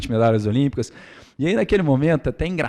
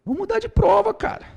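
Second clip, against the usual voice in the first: much higher, laughing and half shouting; doctor laughing at the rivals, pitched way up and loud; laughing exasperated outburst; 4 dB louder than usual. Retold or imitated. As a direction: blurt it out in laughing disbelief, as if the task is hopeless. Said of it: "a minha vontade era olhar pra você e falar assim".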